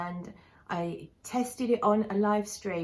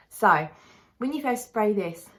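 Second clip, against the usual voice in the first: ordinary talk, nothing marked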